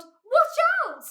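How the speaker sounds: very high, loud warning; yell. A narrator's reading. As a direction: yell out in alarm. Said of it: "and then he yelled".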